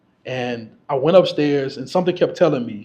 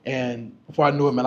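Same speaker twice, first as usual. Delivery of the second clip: usual speaking voice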